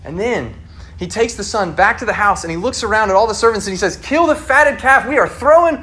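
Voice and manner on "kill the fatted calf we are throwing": high, loud, slow proclamation; excited father. Retold or imitated. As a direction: announce the feast with joy. Father announcing party to servants.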